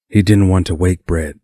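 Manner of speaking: plain reading voice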